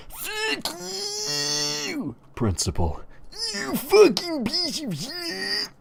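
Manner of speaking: high-pitched drawn-out screech; hammed-up screaming tantrum. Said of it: "Eric is screaming".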